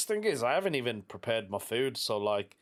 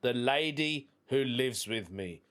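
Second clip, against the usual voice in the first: ordinary talk, nothing marked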